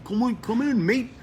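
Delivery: high, put-on Aussie accent; hammed-up Australian mate-speak. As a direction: call it like an Australian trainer. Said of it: "with that Australian accent".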